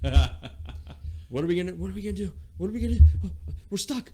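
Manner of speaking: high, loud, frantic voice; panicked kid. Retold or imitated. Son panicking at the edge of the coaster drop, high and loud. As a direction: panic like you're stuck at the top. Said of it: "the look on his face".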